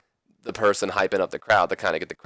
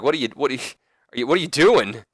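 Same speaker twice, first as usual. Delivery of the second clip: higher, stammering mock disbelief; shocked; 5 semitones higher, 3 dB louder, faster than usual. Wrestler questions his manager's acceptance, mock shocked stammer. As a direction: trip over the question as if stunned. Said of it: "Kurt's just shocked".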